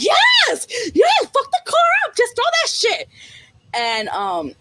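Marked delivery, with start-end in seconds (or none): 0.0-3.1 very high, loud screech; excited yelling. Retold or imitated. Viewer urging the character to wreck the car, screeching. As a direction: screech it, egging them on.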